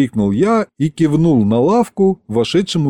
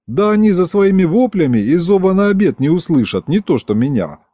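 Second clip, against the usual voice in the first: ordinary delivery, nothing marked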